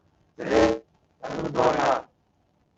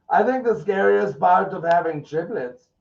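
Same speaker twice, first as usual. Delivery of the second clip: higher, mock-dumb tweet reading; 8 semitones higher, 4 dB louder than usual. Streamer reads the triplets tweet in a mock-dumb voice. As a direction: read it like you believe it.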